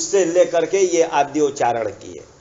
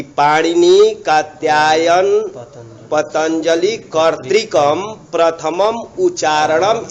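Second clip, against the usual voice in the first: text recitation; 4 dB louder, slower than usual. Acted. Reads commentary line slowly.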